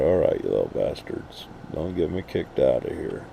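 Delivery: low mock-stern talk to the chickens; scolding chickens. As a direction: grumble at the noisy birds to hush. Scolding his squawking chickens.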